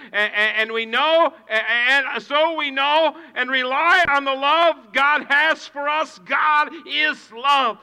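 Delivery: loud, high, slowed preached verse; preaching scripture. Acted. Pastor proclaiming First John to the congregation. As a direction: proclaim it to the back row.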